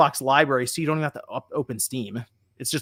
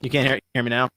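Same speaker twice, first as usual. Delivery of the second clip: staticky connection; faster than usual. Real, not acted. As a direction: voice breaking up over a crackly line. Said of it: "you're all staticky".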